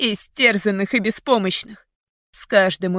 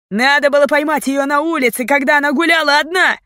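loud, high accusing shout; angry shout; 7 semitones higher, 5 dB louder than usual. A narrator's reading. Bandit blaming the gang, angry shout. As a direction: shout the blame out. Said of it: "крикнул кто-то".

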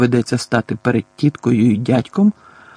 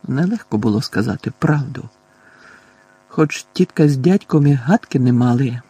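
usual speaking voice

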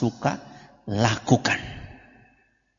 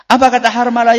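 raised preaching voice; 11 semitones higher, 8 dB louder than usual. Acted. Preacher quotes a hadith aloud.

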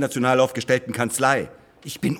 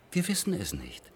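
mock whisper; 3 semitones lower, 9 dB quieter than usual. Mocking the experts' answer.

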